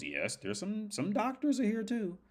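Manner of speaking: put-on voice climbing very high; imitated guide answering. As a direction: play the guide, pitch soaring upward.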